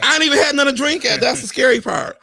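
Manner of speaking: loud fast rant; excited. Real, not acted. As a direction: rush it out, worked up. Reacting to bug, no drink.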